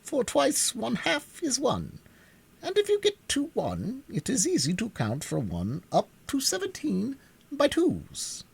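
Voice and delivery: pompous bug, lofty and drawn out; high, slow, learned insect; Wogglebug voice. A narrator's reading. Wogglebug explains counting.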